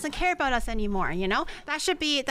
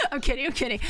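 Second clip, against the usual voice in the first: ordinary talk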